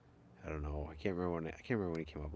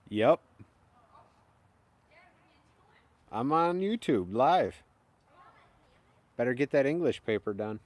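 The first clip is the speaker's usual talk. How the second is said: higher and louder, called to another room; raised voice to the family off camera; calling out; 7 semitones higher, 8 dB louder than usual. Real, not acted.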